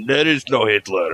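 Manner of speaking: put-on Russian accent; jokey mock Russian accent. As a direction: deny it in a hammy Russian accent. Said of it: "saying that with a Russian accent".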